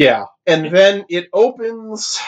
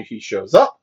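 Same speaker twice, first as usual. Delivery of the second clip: usual speaking voice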